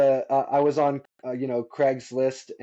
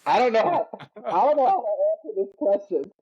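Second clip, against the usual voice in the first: flustered recruit; 7 semitones higher than usual. Retold or imitated. Recruit answering drill sergeant.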